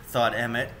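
ordinary delivery

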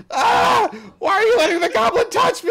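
very high, loud panicked shout; pained shout. Acted. Patient yelling at the nurses, high panicked shout. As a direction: scream it in outrage. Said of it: "he's like".

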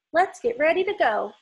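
lively storybook read-aloud; reading to children. Acted. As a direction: read it brightly for little kids. Reading picture book to kids.

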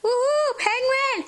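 high, loud excited whoop; excited cheer. Real, not acted. Excited about toy penguin.

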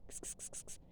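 whispered ks-ks-ks; kitty call. Real, not acted. Owner calling the cat with a soft ks-ks. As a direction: hiss softly to call the cat.

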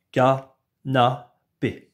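slow, syllable by syllable ka-na-pé; robot voice. Shown as a demonstration. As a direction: say canapé like a robot, each syllable apart. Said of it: "redis-moi le mot canapé comme un robot". (canapé en voix de robot, syllabe par syllabe)

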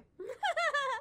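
high, loud burst of laughter; loud cackle. Real, not acted. Host laughs at her own in-law joke, cackling.

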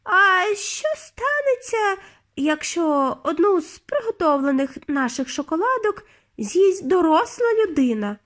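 high-pitched querying witch; witch asking a question. A narrator's reading. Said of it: "пролунав чийсь голос".

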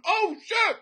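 high, loud, comic panic; high-pitched mock alarm; talking deer. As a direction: squeal it as a terrified talking deer. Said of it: "but with his mouth open".

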